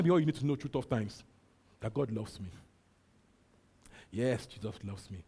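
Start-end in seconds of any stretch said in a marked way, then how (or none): none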